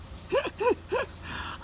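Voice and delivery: high wheezy giggle; amused laugh. Real, not acted. Grandson watching grandad wander off, high breathy laugh.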